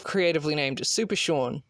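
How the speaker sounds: ordinary talk, nothing marked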